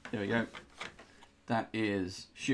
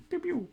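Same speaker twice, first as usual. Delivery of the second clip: high squeaky pew pew, much higher; mock laser zaps. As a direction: zap it like a toy blaster. Magic missile sound effect.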